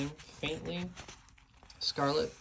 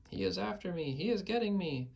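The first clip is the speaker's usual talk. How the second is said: high frantic wail of terror; panicked cry; 7 semitones higher than usual. A narrator's reading. Giant cries that Jack is after him, high panic. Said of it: "screamed the flesh lump eater".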